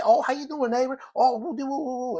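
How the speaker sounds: imitating neighbors greeting each other gleefully; cheery neighbor greeting. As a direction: ham up the overly warm hello. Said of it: "they say".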